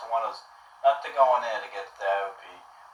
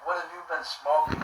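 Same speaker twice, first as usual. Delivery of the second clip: higher-pitched imitation of the grilling homeowner; suspicious homeowner; 7 semitones higher than usual. Homeowner grilling the soaked boy, in a raised mimicking voice. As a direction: ask it up high, mimicking a nosy, baffled man. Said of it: "He's like".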